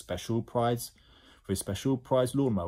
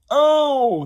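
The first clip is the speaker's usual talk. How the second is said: high, loud yelp; cry of pain; 13 dB louder than usual. Acted. Twin whacked by jelly baby, cry of pain.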